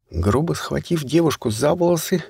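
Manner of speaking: usual speaking voice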